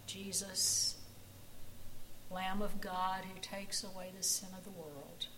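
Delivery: soft, reverent prayer voice. Acted. Pastor leading the congregation in prayer, soft and reverent. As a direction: pray quietly and humbly.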